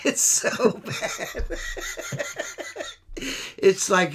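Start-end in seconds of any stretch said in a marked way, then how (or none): 0.0-1.6 breathless laughing whisper. Real, not acted. Laughing at friend's terrible mustache, breathless wheeze.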